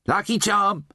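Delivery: high, cheery sales call. Acted. Slaughterer offering charm to clodder-trog, high cheery call. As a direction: pipe up cheerily to a passing customer. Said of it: "the slaughterer said cheerily".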